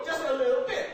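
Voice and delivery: snooty put-on British accent; uppity British accent. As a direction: imitate an uppity Brit adding a polite afterthought. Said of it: "that uppity British accent".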